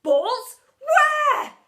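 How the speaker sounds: loud, high, urgent question; demanding shout. A narrator's reading. Teacher wants the pupil to show him balls, demanding shout. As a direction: demand it at the top of your voice. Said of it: "He demanded".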